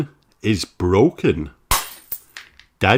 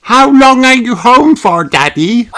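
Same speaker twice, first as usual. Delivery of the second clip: high, loud eager pup voice; eager little son asking; Danny asks; 8 dB louder than usual. A narrator's reading. Danny asking his returned daddy, high eager voice. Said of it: "asks Danny".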